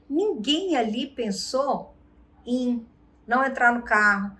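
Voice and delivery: ordinary talk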